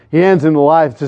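ordinary talk, nothing marked

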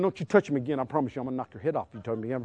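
low, very quiet, rapid warning; hushed, rushed parental warning, re-enacted; acted muttered threat. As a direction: mutter a threat under the breath. Re-enacts threat to squabbling son.

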